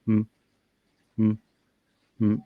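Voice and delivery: short closed-mouth hums, one by one; little testing hums; checking hums. Shown as a demonstration. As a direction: hum briefly to test the voice still works. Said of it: "I'd go like this".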